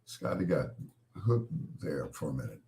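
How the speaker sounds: normal talking voice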